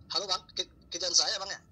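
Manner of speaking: ordinary delivery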